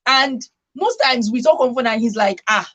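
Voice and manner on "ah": usual speaking voice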